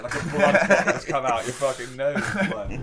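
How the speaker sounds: usual speaking voice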